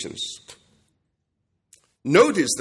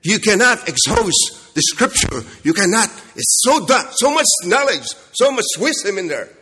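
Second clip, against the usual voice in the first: higher and louder, fervent; impassioned preaching; 6 semitones higher, 5 dB louder than usual. Acted. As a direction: swell with awe at scripture. Preacher's rising climax.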